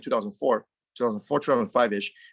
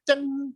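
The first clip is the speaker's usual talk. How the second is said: high bright ding imitating a lighter lid; lighter ding sound. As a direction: sing out the click of a lighter.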